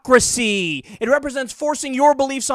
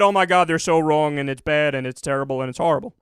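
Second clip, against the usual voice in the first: mocking them, lower, in a whiny put-on voice; mock outrage; 7 semitones lower than usual. Mocking the other side's outrage at terrorism, lower whiny voice. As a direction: parody their fake horror. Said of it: "But when they do it".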